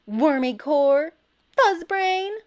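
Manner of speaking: high, loud angry insults; loud childish name-calling; trading insults. A narrator's reading. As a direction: shout names at each other. Shouted insults back and forth.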